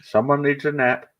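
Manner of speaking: mock scolding. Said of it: "See someone have a full-on meltdown".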